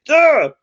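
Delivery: loud, high mock groan; frustrated groan. Driver dreading the camera bill, exaggerated frustrated groan. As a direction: let out a hammed-up ugh. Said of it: "so frustrated 'cause I knew".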